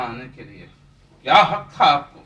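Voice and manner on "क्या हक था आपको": loud, angry demand at his father; angry shout. Acted. Drunk son yells at his father over his visit, angry shout. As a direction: bark the accusation straight at him.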